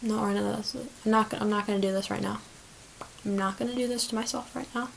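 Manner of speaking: very quiet aside to oneself; talking herself down. Real, not acted. Calming herself about her listener count, very quiet self-talk. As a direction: drop to an under-the-breath self-reassurance.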